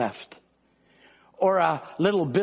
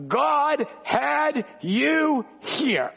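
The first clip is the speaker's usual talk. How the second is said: drawn out, very high preaching cry; preaching at full force; 8 semitones higher, much slower than usual. Acted. Preacher to congregation, a slow, soaring preaching cry. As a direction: proclaim it slowly to the whole room.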